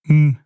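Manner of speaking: short closed-mouth grunt of approval; single grunt. Acted. Barman signalling the cat is doing it correctly, single grunt. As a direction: grunt once to signal yes. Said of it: "I can just grunt".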